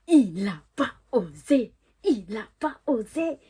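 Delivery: "pas osé" pounded out slowly and loudly; incredulous emphasis. Retold or imitated. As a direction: separate each syllable of "il a pas osé". Outrée qu'il soit venu. (« pas osé » martelé, incrédule)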